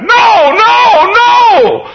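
very high, loud wail; shouted protest. Retold or imitated. Congregation hears pastor is resigning, shouted protest. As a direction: cry out as a distraught congregation. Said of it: "several folks shouted".

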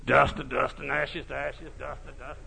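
soft, dead-man's mock funeral intonation; cemetery talk. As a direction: drone it like a dead man at the grave. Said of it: "cemetery talk".

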